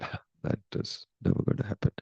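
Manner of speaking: soft breathy no, said kindly; gentle whispered refusal. Retold or imitated. King gently refusing the queen, whispered. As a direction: brush her off in a hushed, friendly way. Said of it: "very nicely".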